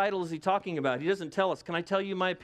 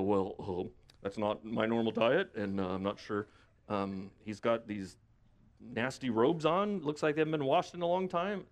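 reluctant fish; 7 semitones lower than usual. Acted. Fish told by God to swallow Jonah, reluctant.

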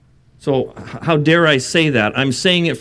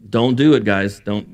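ordinary talk

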